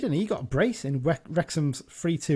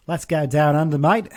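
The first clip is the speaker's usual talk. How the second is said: bad Australian accent, hammed up; a jokey go at an Aussie voice; 8 dB louder than usual. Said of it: "terrible Australian accent".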